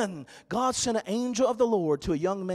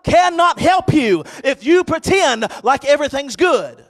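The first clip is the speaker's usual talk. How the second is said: loud, raised preacher's voice; full-force preaching; 5 semitones higher, 9 dB louder than usual. Real, not acted. Pastor urging the church to stop pretending, full-force preaching. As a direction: drive each phrase out to the back pews.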